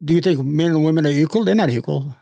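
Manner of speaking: usual speaking voice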